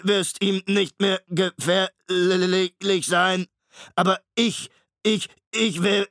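laut, langsam, höher, leiernd wie ein kaputtes Tonband; leiernde Maschinenstimme. A narrator's reading. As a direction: zieh den Namen wie ein defektes Band. Shrikes stotternde Maschinenstimme. (Shrike spricht Hester an, leiernd und knackend wie ein Tonband)

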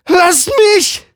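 sehr hoch und laut geschrien; Schrei. Acted. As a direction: schrei ihn verzweifelt an. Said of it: "schreit sie ihn an". (verzweifelter lauter Schrei)

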